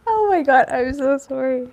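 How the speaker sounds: high, apologising through giggles; sorry while you can't stop laughing; real laughter in the voice; laughing apology. Real, not acted.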